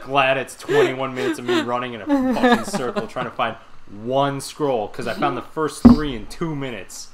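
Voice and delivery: laughing loudly while ranting; loud exasperated laughing rant. Real, not acted. Laughing rant about game.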